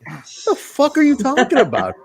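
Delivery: high, disbelieving retort; incredulous. Retold or imitated. Host answering the angry fan, high incredulous. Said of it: "And I'm like".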